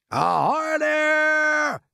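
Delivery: very high, long-drawn hailing shout; police chief's shout. A narrator's reading. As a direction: holler up to someone far above. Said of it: "shouted the chief of police".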